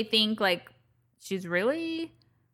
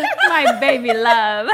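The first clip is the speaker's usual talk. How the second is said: fond laugh; 8 dB louder, slower than usual. Retold or imitated. Answering her son, laughing.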